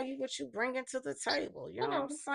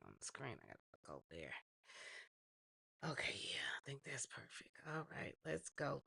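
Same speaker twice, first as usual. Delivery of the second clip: whispering; 7 semitones lower, 10 dB quieter than usual. Real, not acted. Mutters setting up stream.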